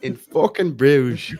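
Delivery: put-on an over-the-top Dublin accent for laughs. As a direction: belt it out like a Dublin hard man. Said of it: "in your best Dublin accent".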